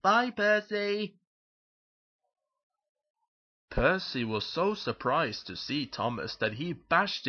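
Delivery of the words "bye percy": high, drawn-out sing-out goodbye; calling out. Acted. Thomas calling to Percy as he rushes off, cheery shout. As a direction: call it high and slow.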